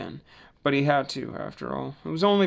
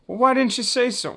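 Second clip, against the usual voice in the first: much higher, louder eager question; eager Mac; 10 semitones higher, 5 dB louder than usual. A narrator's reading. Mac agreeing to wiggle the dummies, excited. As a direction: bounce up with delight.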